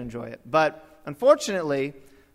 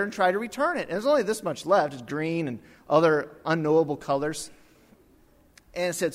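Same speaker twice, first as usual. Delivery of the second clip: usual speaking voice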